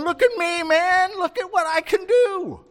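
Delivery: very high, loud acted jubilation; elated exclamation. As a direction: play a healed man showing off his new legs. Healed man jumping and walking.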